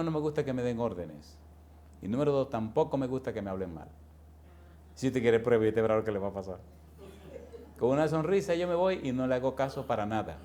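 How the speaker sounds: ordinary talk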